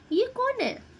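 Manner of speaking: ऊँची आवाज़ में चौंककर पूछिए; अचानक हैरानी से, ऊपर चढ़ती आवाज़; हैरान सवाल. Real, not acted. Reader puzzled by a card. (Card देखकर खुद से पूछती पाठिका, हैरान)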